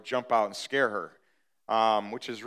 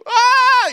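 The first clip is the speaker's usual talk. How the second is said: piercing high shriek, full volume; retold scream; 16 dB louder than usual. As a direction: let out a terrified kid's scream. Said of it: "I just started yelling, screaming".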